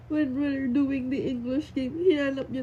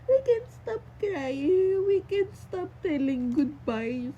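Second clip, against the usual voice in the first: slow, higher, tearful; crying; 5 semitones higher, slower than usual. Real, not acted. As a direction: voice breaking at the memory. Recalling farewell. (naiiyak nang mabagal)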